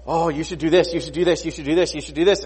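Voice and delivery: gushing, self-serving enthusiasm; eager Haman. Acted. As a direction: pile up suggestions with mock eagerness. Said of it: "And he, and he's like".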